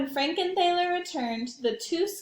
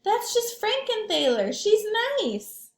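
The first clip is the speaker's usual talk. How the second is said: high, loud reassuring voice; reassuring monster; 9 semitones higher, 4 dB louder than usual. Acted. Leonardo reassures Sam, loud cheerful reassurance. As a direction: comfort a scared kid. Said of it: "said Leonardo to Sam".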